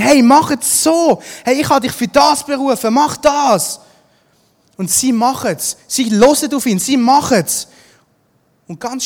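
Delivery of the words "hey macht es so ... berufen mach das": loud, high, emphatic order; raised voice, commissioning them; urgent command. Acted. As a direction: call out the instruction forcefully. Acting Jesus giving the disciples orders.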